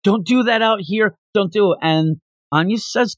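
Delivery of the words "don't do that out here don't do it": freaking out. Retold or imitated. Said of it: "Yor freaks out".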